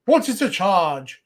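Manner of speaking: mock declamation. Mimicking a man protesting his arrest over dinner, hammed-up declamation. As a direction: declaim it like a pompous stage actor. Quoting a meme speech in mock grandeur.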